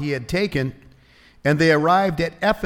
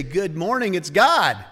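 higher, rising to a loud finish on God; cheery wake-up greeting; 3 semitones higher, 3 dB louder than usual. Acted. Believer waking up to greet God, cheery greeting. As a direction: greet the day eagerly, building to God. Contrasting two ways of waking.

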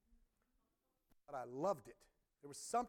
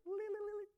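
sung organ flourish, much higher; organ riff imitation. Preacher imitates the church organist's riff, sung high. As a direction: mimic the gospel organ stab in falsetto. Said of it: "And the organ player".

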